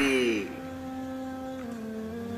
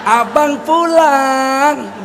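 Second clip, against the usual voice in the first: very slow, drawn-out loving call; sweet pet-name call; 5 semitones higher, 3 dB louder, much slower than usual. Retold or imitated. Husband sweetly calling his little wife as he comes home. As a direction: stretch it out fondly.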